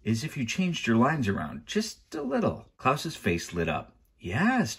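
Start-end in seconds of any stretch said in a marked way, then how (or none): 0.0-2.7 high, tentative coaxing; careful suggestion. A narrator's reading. Girl coaxing the judge to change her lines, carefully. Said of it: "Violet said carefully". 4.2-4.8 high, eager burst; eager agreement. A narrator's reading. Boy backing his sister's idea, eagerly. Klaus lights up, urging her on.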